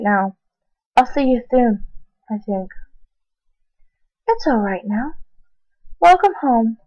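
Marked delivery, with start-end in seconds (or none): none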